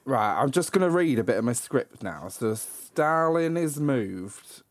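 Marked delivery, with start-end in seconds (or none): 2.3-4.6 slow, higher put-on southern English accent; attempted southern accent. Shown as a demonstration. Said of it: "let me see if I can do it".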